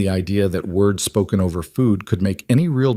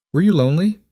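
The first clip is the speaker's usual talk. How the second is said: put-on higher, curious child's question; little girl voice; 9 semitones higher, 4 dB louder, faster than usual. A narrator's reading. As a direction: innocent young girl asking. Child asking, timbre shift.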